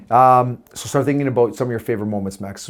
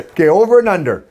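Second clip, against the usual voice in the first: higher, louder host voice for "over and under"; mock game show intro, pitched up and loud; game show announcer; 6 semitones higher, 6 dB louder than usual. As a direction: announce the segment like a quiz host. Announces game segment title.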